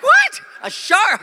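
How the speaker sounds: high, loud incredulous yelp; mock startled disbelief. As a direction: squawk the question back in alarm. Retold startled reply to daughter.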